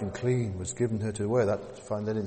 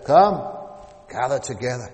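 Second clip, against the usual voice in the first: loud cry; 9 dB louder than usual. Acted. Preacher voicing the angel calling the birds, loud. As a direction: call it out to the birds. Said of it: "cried in a loud voice".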